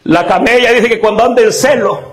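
normal talking voice

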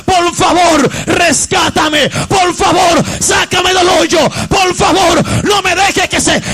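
loud, urgent preached plea; pleading psalmist. Acted. Psalmist begging God for rescue, loud pleading. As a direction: cry out for rescue in short bursts. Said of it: "El salmista le estaba diciendo a Dios".

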